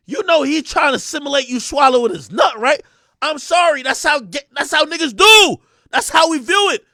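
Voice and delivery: loud, high-pitched indignation; heated arguing. Retold or imitated. Man setting his date straight, heated argument. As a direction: argue it at full volume.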